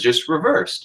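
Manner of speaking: ordinary delivery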